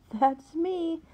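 high, chirpy brush-off; brightly. Acted. Liz answers the church lady with forced brightness. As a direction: sing it out brightly to move on. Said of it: "she said brightly".

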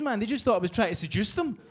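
normal talking voice

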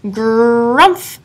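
a big grumbling hiccup noise; loud hiccup. Acted. Badger's loud hiccup sound.

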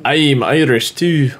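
slow, failed attempt at Irish; botched Irish accent. Joking about nationality, badly faked Irish accent. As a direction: try an Irish accent and miss. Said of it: "I don't know what accent that is".

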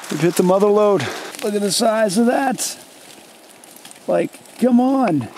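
genuinely thrilled at a huge find; excited. Real, not acted. Host showing a huge mushroom to viewers, excited and high. Finding a giant mushroom patch.

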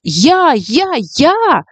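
put-on kids clamoring high, me me me; eager class shouting to be picked first. Kids call out eagerly to be first, excited.

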